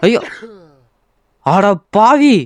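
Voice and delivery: loud, high cry of alarm; high-pitched, exasperated yelling; panicked outburst. Real, not acted. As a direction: shout in dismay as it all goes wrong. Game mishap, panics at the mess.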